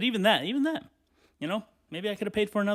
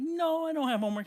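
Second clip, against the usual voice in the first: high, sweet denial; high innocent lie; 11 semitones higher than usual. Retold or imitated. Kid lying to grandma.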